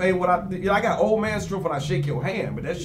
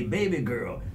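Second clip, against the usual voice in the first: put-on old man voice; 5 semitones lower, 5 dB quieter, slower than usual. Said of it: "old man say".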